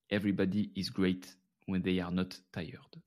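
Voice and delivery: slow English with a French accent. Shown as a demonstration. Podcaster reading an English quote to listeners, French accent. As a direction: read it unhurried, keeping a French accent on the English. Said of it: "Avec cet accent français du mec qui en fait sait parler anglais".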